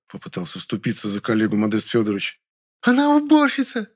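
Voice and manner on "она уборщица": high female voice full of disdain; disgusted retort. A narrator's reading. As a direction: sneer it in falsetto. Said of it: "скривилась Маша". (презрительно, высоким голосом)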